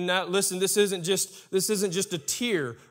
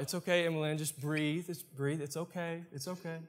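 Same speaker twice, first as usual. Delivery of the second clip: soft, gentle reassurance; calm a sobbing child; soothing parent; 8 dB quieter than usual. Retold or imitated. Parents calming their crying four-year-old daughter, softly soothing. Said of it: "And we're like".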